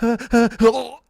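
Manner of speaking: loud, high heaves building to a retch; mock heaving before throwing up; imitated retching. Driver imitates daughter about to throw up, mock retching. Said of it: "she's like".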